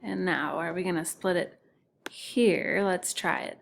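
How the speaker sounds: normal talking voice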